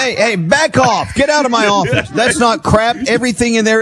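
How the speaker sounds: high-pitched mock outrage; mock indignant. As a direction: protest like you're insulted. Defending his messy office.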